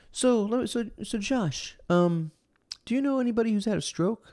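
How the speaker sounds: high-pitched, hesitant imitation of an odd man; awkward stranger. Imitating odd stranger asking fisherman about strokes, awkward.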